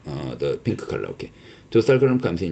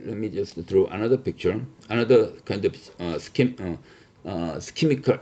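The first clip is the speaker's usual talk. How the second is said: usual speaking voice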